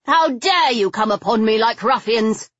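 high, loud affronted lady; haughty outburst at an affront; indignant demand. A narrator's reading. Affronted lady caller scolds her rough hosts, indignant demand. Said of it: "she demanded".

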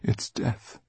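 near-whispered dread; hushed thought. A narrator's reading. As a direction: breathe out the realisation softly. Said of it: "she thought".

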